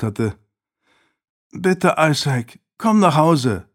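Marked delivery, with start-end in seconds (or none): 1.5-3.7 high, drawn-out plea; imploring. A narrator's reading. Sister begging her brother to come home, imploring. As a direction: beg him earnestly. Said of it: "beschwor seine Schwester ihn".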